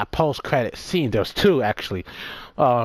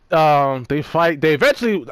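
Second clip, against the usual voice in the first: normal talking voice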